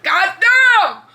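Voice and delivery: a prince exploding in disbelief; furious outburst. Retold or imitated. Prince Mandex yelling at Callie in outrage. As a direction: shout it high and loud in outrage.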